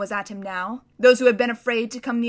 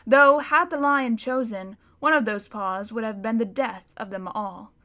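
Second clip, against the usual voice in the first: usual speaking voice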